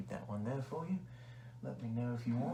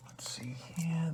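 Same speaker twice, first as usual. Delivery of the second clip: slow whisper; 6 semitones higher, slower than usual. Acted. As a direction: breathe it out slowly. Whispering while checking.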